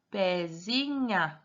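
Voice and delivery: pezinha stretched slowly, every syllable held; drawn-out trial reading. Shown as a demonstration. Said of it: "Vamos tentar". (pezinha bem arrastado, testando cada sílaba)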